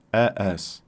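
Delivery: usual speaking voice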